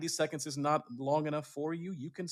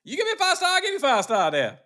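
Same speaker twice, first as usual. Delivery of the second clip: much higher and louder mock Georgia accent, laughing through it; Georgia Uber driver; 9 dB louder than usual. Imitating his Uber driver for the cohost, hammed-up Georgia accent. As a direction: ham up a Georgia driver's pitch. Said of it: "How does he say it in Georgia?".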